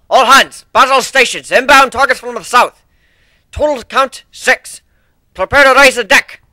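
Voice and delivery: rally the crew over the alarm; battle stations call. Acted. Ship's officer calling the crew to battle stations, shouting.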